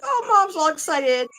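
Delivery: high, loud excited dog voice; imitated dog's eager outburst; Frank, all excited. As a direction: voice an overexcited pet thrilled by its owner. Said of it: "he got all excited".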